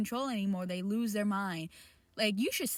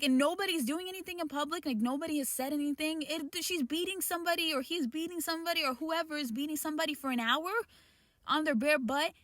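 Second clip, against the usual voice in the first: fast, higher, outraged rant; 6 semitones higher, faster than usual. Real, not acted. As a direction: spill it out in disbelief, picking up speed. Outraged rant about public beating.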